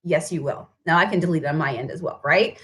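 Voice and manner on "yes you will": quiet firm reply. Retold or imitated. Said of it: "And I said".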